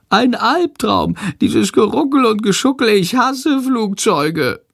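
very high, squeaky moaning; whining bat. A narrator's reading. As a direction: whine about the flight. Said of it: "jammert Shandor".

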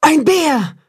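high, startled shout of alarm; wolf mother shrieks in fright, a hint of panic; frightened cry. A narrator's reading. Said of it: "ruft die Wolfsmutter erschrocken".

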